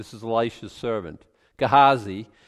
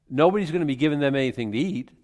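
ordinary talk, nothing marked

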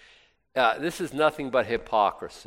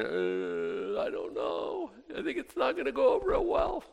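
hesitant excuse; 9 semitones higher, 6 dB quieter than usual. Retold or imitated. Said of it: "And we're saying".

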